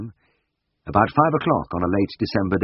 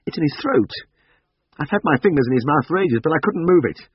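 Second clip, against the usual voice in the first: ordinary delivery, nothing marked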